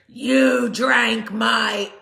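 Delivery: angry shout at her sister; slow furious shout about the drink; retold yell. Daughter yelling at sister over drink.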